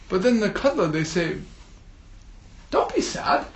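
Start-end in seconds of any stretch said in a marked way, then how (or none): 2.7-3.5 soft whispered comfort. Acted. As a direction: breathe it gently. Katla fish consoles Rui.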